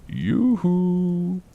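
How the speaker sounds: high, long-held sing-song yoo-hoo; eerie taunting call. Retold or imitated. Unseen presence trying to scare or intimidate, eerie high call. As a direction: lilt it up high to unsettle. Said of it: "as if it was trying to scare me".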